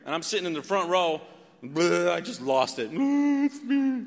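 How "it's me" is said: put-on high, cracking voice of a teen sobbing at the altar; retold sobbing. Teen at altar call admits it's him, sobbing. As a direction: let it break out as a crying confession. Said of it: "So I come up sobbing".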